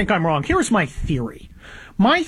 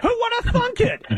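higher-pitched, sarcastic disbelief; mock surprise; 6 semitones higher than usual. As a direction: feign shock at the painfully obvious, pitch climbing high. Sarcastic feigned shock at news.

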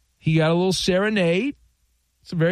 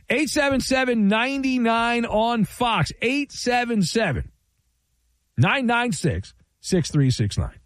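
phone number read; 7 semitones higher than usual. Acted.

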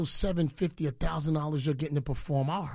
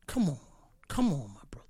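mocking whisper; 3 semitones lower, faster than usual. Real, not acted. Taunting a rival on air.

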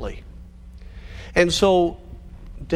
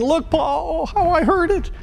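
put-on high childish whimper over a pinched finger; little son whining to his busy father, high and pitiful; whiny hurt little boy; 3 dB louder than usual. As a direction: play the toddler showing off his sore finger. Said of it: "said with an expressive suffering".

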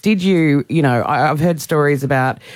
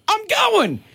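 high-pitched charging cry; excited cry. Retold or imitated. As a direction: announce it as you bolt onto the field. Acts out charging onto the field.

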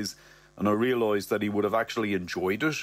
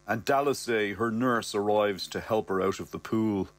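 ordinary delivery

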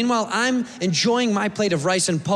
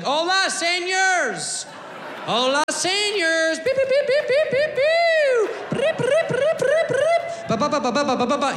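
[name] showing off his bad Spanish, high and laughing; mock Mexican accent; 11 semitones higher than usual. Comic [name] clowning in fake Spanish, mock accent. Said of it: "talking Mexican".

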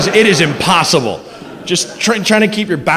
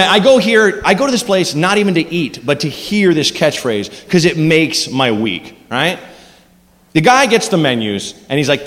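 normal talking voice

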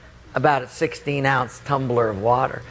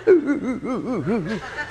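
high, hooting mock-evil cackle; demonic laugh; 6 semitones higher than usual. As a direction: imitate a prankster's demonic giggle. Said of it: "it's a very demonic laugh".

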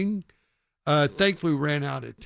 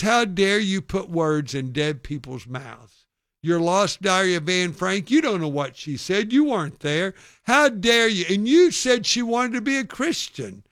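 indignant heckler, building higher and louder; mock tirade rising to a raised voice; retold yelling; 4 semitones higher, 5 dB louder than usual. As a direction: imitate an outraged accuser working up steam. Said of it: "he started yelling some other things".

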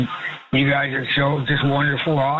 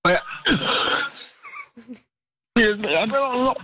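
strained, higher words between coughs; choking, coughing; 8 semitones higher than usual. Real, not acted. Caller with cereal stuck in his throat, choking and coughing. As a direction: talk through a real coughing fit. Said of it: "I thought you were acting".

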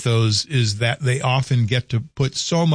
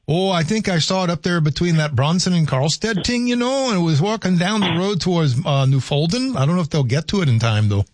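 put-on pitched-up joking Scandinavian-Minnesotan accent; mock Upper Midwest Scandinavian accent, pitched up; 5 semitones higher than usual. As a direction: answer the caller's Scandinavian bit in kind, higher. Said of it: "real Swedes and Norwegians are shaking their head".

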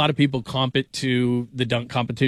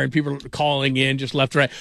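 normal talking voice

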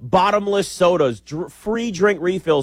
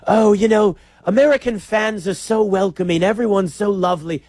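a hammed-up, higher British accent; terrible British accent; 6 semitones higher than usual. Imitating a foreign fan gushing about American welcome in a bad British accent. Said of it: "I'm just gonna do a British accent".

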